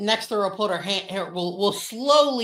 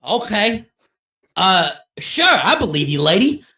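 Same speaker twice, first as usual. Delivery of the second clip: louder, unconvinced go-along; sceptical humoring; 7 dB louder than usual. Acted. Nextra humoring the odd lieutenant, wary and unconvinced. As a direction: humor her warily.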